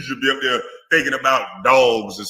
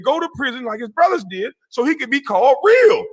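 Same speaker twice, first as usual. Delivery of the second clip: angry shout; 10 semitones higher than usual. Real, not acted. Calling out a rival, he rants in an angry shout. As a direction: rail at him, full volume. Heated rant about rival.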